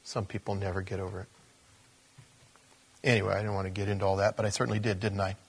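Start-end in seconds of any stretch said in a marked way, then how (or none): 0.0-1.3 the same line dropped much softer, fast; a much softer echo of the line; softer repeat. Shown as a demonstration. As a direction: let it sink in quietly.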